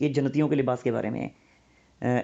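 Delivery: ordinary talk, nothing marked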